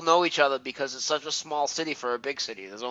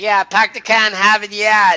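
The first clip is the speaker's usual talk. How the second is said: put-on much higher, louder, over-the-top Boston accent through a laugh; exaggerated Boston accent; 10 semitones higher, 10 dB louder, slower than usual. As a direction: ham up the Boston accent ten times worse. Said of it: "I'll make it ten times worse".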